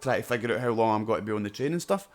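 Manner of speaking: normal talking voice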